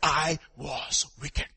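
I was wicked said very slowly; slow confession. Retold or imitated. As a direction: draw out I was wicked like a hard admission. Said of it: "never will anybody say".

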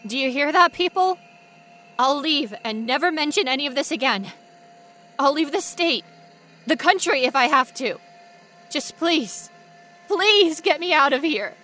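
frantic pleading shout; desperate shouting. Acted. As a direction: beg at the top of your voice.

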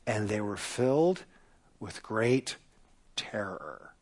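hushed, breathless whisper of terror. Acted. Preacher reading the sailors' fear to the church, a hushed whisper. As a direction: let the fear drop to a whisper.

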